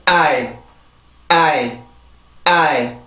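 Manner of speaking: I said as one stressed glide, ah into ee; stressed English I. Shown as a demonstration. Teacher modelling the stressed vowel of ice for students. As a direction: lean on I, one beat. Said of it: "앞에만 강세가 가요".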